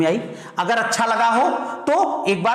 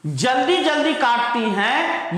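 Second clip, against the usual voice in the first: slow, higher, stretched phrase; drawn-out example, pitched up; demonstrative reading; 4 semitones higher, slower than usual. Shown as a demonstration. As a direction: read it out slowly to prove it sounds right. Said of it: "ये ज्यादा सही लग रहा है".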